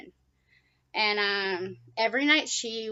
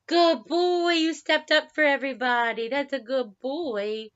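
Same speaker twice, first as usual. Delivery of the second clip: pet talk; 5 semitones higher, 6 dB louder, slower than usual. Real, not acted. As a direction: gush praise at the bird. Praising bird in pet voice.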